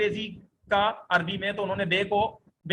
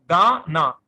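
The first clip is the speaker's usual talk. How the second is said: गाना called out much louder; emphatic answer; 3 semitones lower, 10 dB louder, much slower than usual. Shown as a demonstration. Teacher loudly giving the answer गाना to students. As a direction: call out गाना firmly to the class. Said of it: "कैसे पढ़ेंगे हम इसको".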